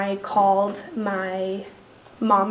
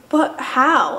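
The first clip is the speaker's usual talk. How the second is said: loud, higher-pitched helpless question; retold bewildered protest; pleading disbelief; 4 semitones higher, 6 dB louder than usual. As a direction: plead it, voice lifted. Pleading with dad for rescue.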